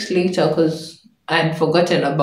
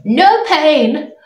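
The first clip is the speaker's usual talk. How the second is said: higher, emphatic proclaiming voice; imitated motherly saying; 11 semitones higher, 4 dB louder than usual. Mom telling her daughter a saying, imitated emphatic voice. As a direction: declare it like a mother's motto.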